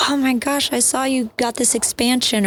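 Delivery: high, gushing hello; excited greeting. Retold or imitated. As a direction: gush like seeing an old friend. Quoting excited reunion at show.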